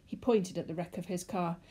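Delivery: usual speaking voice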